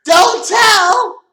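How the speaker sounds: very high shriek. Acted. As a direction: shriek it like a joke. Shrieked punchline after whisper.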